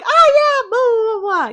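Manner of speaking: high airy brush-off voice; mock wife brushing off her husband, in a high voice. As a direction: toss it off like a carefree wife. Mocking cop's wife brushing off.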